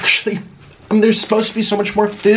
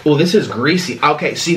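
usual speaking voice

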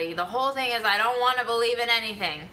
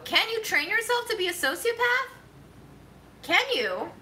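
high teasing voice; 11 semitones higher than usual. Real, not acted. Teasing question to chat.

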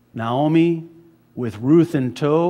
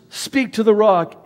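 much higher and louder divine command; commanding God; 8 semitones higher, 7 dB louder than usual. Retold or imitated. God telling Moses to speak to the rock, loud and high. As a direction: proclaim it as God's order. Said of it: "And so God says".

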